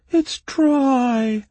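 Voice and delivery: very high, loud whine; small complaining voice. A narrator's reading. Bog creature in the darkness, complaining shrilly. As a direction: complain in a tiny piping voice. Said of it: "complained the small voice".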